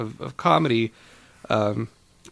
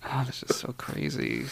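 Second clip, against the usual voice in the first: whispering; 11 dB quieter than usual. Real, not acted. Aside while co-host talks.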